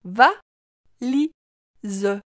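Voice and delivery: "Va, li, ze" as three separate beats; split into syllables. Shown as a demonstration. Teacher helping the child hear li, syllable by syllable. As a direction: break valise into va... li... ze, final e voiced. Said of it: "Tu entends bien la syllabe li".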